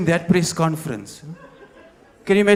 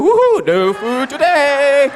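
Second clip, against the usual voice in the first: high, slow, loud mock-cheery whoop; much higher mock-joyful cheer; cheerful homeless guy; 10 semitones higher, 7 dB louder, slower than usual. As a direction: whoop it out absurdly happy. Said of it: "homeless guy go".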